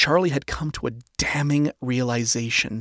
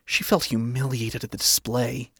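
usual speaking voice

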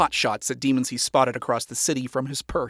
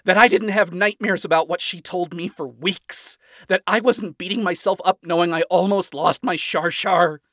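loud, high, self-loathing outburst; shot back; 7 semitones higher, 7 dB louder than usual. A narrator's reading. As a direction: pour out the guilt, pitched up. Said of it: "shot back with a self-loathing look".

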